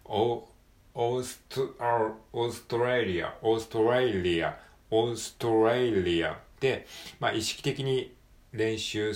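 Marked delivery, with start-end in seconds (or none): 0.0-6.9 "オーストラリア" with the s-t-r cluster run together, no vowels between; English Australia drill. Shown as a demonstration. Teacher drilling Australia against the katakana way. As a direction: repeat Australia with the cluster tight. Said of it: "ならないように".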